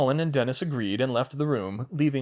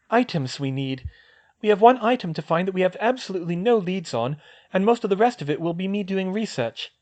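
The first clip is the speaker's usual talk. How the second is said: put-on higher young woman's voice, brisk; girl's voice; 8 semitones higher, 4 dB louder than usual. Hermione planning with Luna, in a higher girl's voice. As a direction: voice the girl, a clear step up in pitch.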